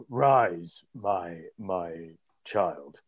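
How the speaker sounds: slow, grand deity pronouncement; warm regal boom. Acted. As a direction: bless them like a god. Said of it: "put on his bard moment".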